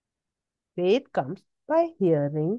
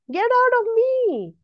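high, sharp command to the devil; preacher ordering Satan out; commanding rebuke; 14 semitones higher than usual. Retold or imitated. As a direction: order sickness out with authority. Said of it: "So, I got cold".

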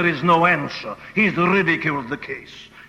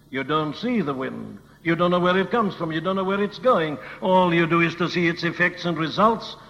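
normal talking voice

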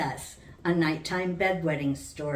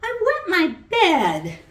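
put-on high, loud small-girl voice calling for mommy; a worried little girl calls her mother; little girl crying out; 13 semitones higher, 8 dB louder than usual. A narrator's reading. Little Sally calls Mommy about her wet bed, high and loud. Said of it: "she cried".

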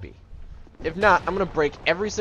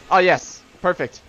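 ordinary talk, nothing marked

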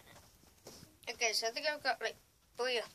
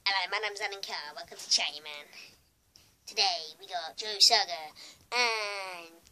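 lightly voiced chat show host impression, louder; put-on host introduction, louder, drawn out at the end; Alan Carr; 2 semitones lower, 7 dB louder than usual. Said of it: "I can do his impression".

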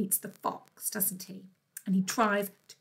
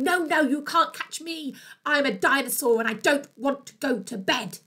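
higher, louder cheeky taunt; loud dinosaur taunt; 3 semitones higher, 6 dB louder than usual. Acted. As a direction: shout it like a runaway child. Dinosaur shouts his refrain.

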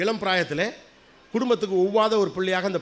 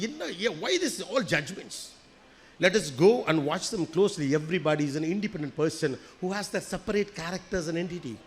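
very slow, softer reflective English; slow soft English aside; 4 dB quieter, much slower than usual. Real, not acted. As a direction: draw it out gently, thinking aloud. (மெதுவாக மென்மையாக ஆங்கிலத்தில்)

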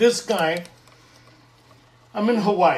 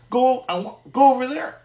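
higher, louder insistent command; God insisting loudly; 7 semitones higher, 7 dB louder than usual. Retold or imitated. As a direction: push him to go, like a voice from above. God's voice insisting he go.

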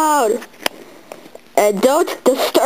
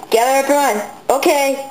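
usual speaking voice

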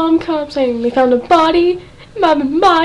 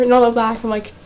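ordinary talk, nothing marked

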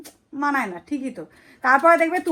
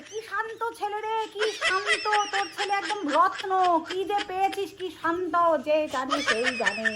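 mock praise; 8 semitones higher, 3 dB quieter than usual. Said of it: "ওকে বলে".

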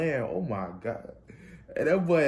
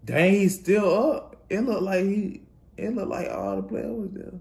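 amazed; 10 semitones higher, 4 dB louder than usual. Real, not acted. Amazed at runner still up.

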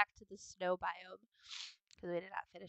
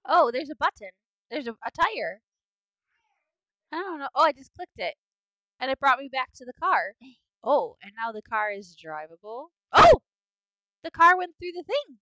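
higher, much louder burst of surprise; excited discovery; 3 semitones higher, 15 dB louder than usual. Real, not acted. Player spots a button and the car moves, excited. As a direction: exclaim as the game suddenly reacts.

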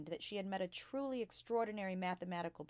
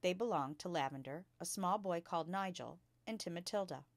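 plain reading voice

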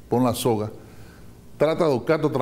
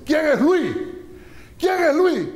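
gunman's demand; 13 semitones higher, slower than usual. Acted. Gunmen burst in demanding Luis.

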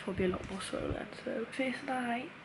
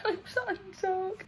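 tearful; 11 semitones higher than usual. Real, not acted. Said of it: "I could literally cry right now".

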